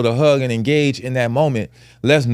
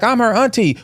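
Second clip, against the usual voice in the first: higher indignant protest; indignant aunt; 7 semitones higher than usual. Retold or imitated. Aunt insisting to the father, higher and indignant. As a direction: insist on your right as her aunt. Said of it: "she was like".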